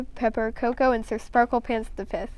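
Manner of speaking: ordinary delivery, nothing marked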